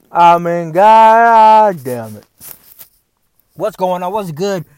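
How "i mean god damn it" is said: loud, drawn-out exasperated curse; exasperated outburst. Real, not acted. Man venting about what he's supposed to do, exasperated. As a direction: belt it out, stretching the words.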